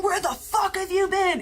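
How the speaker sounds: hammed-up rush at the cop; mock angry yell. Kids rushing the cop car, high mock shout.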